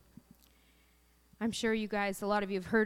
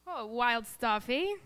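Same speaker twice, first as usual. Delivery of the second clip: ordinary delivery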